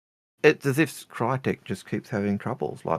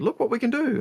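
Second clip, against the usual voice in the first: higher, proud show-off voice; excited boast. Retold or imitated. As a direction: pitch it up like proud developers showing off. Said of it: "they said".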